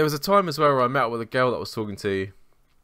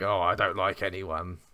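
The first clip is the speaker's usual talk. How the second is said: lightly voiced whiny teen, mocking; mocking the moody lad; 3 semitones lower, 3 dB quieter than usual. Mocking the moody lad who likes no one. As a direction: sneer it like a sulky lad at a party. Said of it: "just that typical, like".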